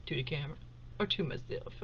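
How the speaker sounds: drops her voice on the repeat; to the camera or to myself, murmured quietly. Shown as a demonstration. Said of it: "Which way do y'all prefer?".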